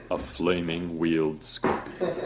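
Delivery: a hint of a sulky child; childish. Priest telling Klaus of the scorpion, mildly childish. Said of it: "said Gunther childishly".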